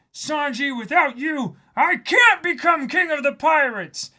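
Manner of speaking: very high, loud cartoon cry, far above own voice; shrill Luffy impression. Reading a fan's comment, squealing Luffy's plea to Sanji. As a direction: wail it like the anime hero begging.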